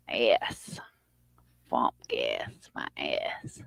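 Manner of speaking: whispered repeat, very soft. Retold or imitated. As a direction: breathe the line out in a hush.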